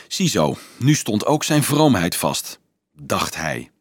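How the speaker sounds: ordinary delivery, nothing marked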